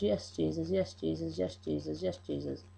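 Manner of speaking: softly fading, near whisper; dying husband's last words. Retold or imitated. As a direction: let it fade to a breath, like last words. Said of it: "The last words he spoke".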